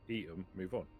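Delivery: normal talking voice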